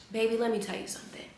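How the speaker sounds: quick hushed aside to the viewer; soft, fast aside. Real, not acted. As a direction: lean in and say it softly, fast. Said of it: "intimate aside".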